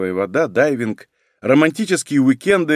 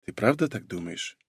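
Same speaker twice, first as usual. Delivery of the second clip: quiet; 5 semitones lower, 9 dB quieter than usual. Acted. Tender late-night question.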